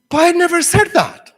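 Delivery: high, loud imitated denial; indignant protest. Jennifer protesting while classmates laugh, loud indignant denial. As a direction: cry it out like a wronged student.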